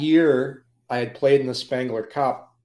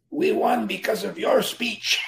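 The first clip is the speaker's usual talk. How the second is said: put-on higher voice with an Italian accent; 9 semitones higher than usual. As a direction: imitate the owner's Italian accent. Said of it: "he has had an Italian".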